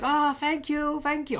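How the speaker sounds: high, bright Korean-accented thanks, imitating the customer; mock Korean accent, pitched well up. Retelling the Domino's customer's grateful thanks, mock Korean accent. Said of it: "I won't do the accent".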